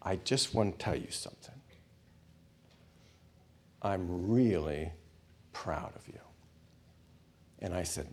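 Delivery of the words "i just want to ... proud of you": retold whisper. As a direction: lean in and confide it gently. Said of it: "And then he whispered".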